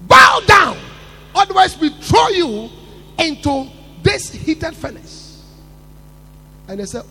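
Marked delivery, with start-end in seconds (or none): 0.1-5.4 loud, high angry command; king's threat. Acted. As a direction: order them to bow, furious.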